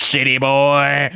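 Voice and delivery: loud, drawn-out taunt from a yokel mechanic; hammed-up backwoods drawl; sleazy mechanic. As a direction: stretch it slowly, mocking the out-of-towner. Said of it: "the guy behind the counter".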